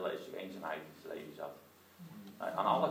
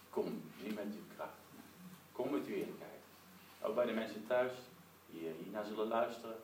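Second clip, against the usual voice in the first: prayer. Acted. Preacher leading the congregation in hushed prayer.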